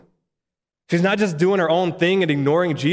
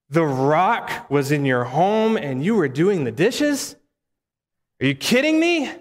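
incredulous. Acted. Onlookers judging a host who did the dishes, incredulous. Imagined onlookers react in disbelief.

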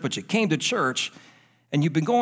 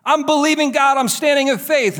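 raised, high preaching voice; 9 semitones higher, 5 dB louder than usual. Acted. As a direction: preach it out with rising fervor. Preacher rising to full force.

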